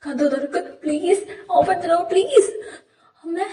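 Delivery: bang on the door and beg through panic; panicked begging. Acted. Locked in the dark, he begs his mother to open the door in terror.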